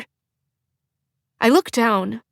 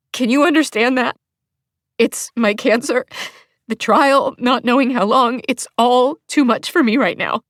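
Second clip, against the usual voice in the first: high, pleading through tears, a little louder; distressed plea; 5 semitones higher, 4 dB louder than usual. Acted. Explaining her cancer to him, high and tearful. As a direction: beg him to understand, close to tears.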